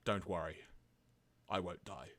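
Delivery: low, soft dying father; hammed-up weak deathbed reassurance, with pauses; Spike. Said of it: "try and recreate the voice I did".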